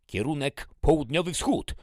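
loud, high command; shouted order. Acted. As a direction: call it out to the column. Major orders marching direction. (głośna komenda)

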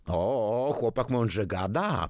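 approving praise. A narrator's reading. Grandfather praises the boy's thrifty idea, approving. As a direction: praise the boy, pleasantly impressed. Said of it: "pochwalił Edmund".

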